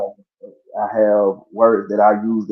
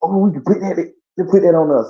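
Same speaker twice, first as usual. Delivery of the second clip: higher, louder, hyped-up reaction; light mock excitement, pitched up; excited retold reply; 8 semitones higher, 4 dB louder than usual. Replying to the engineer who praised his grinding, excited and higher. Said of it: "I like".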